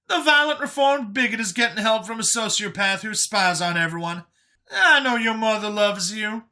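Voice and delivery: high, loud, animated character voice; sarcastic Brian. A narrator's reading. Brian needles Vincent about needing professional help, sarcastic. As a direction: lay on the sarcasm in a high, punchy voice.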